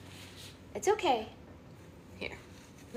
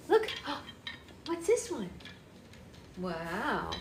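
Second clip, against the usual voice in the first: usual speaking voice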